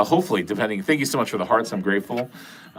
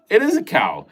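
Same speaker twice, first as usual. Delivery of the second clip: "is" higher and lighter, falling; higher repeat; 9 semitones higher than usual. Shown as a demonstration.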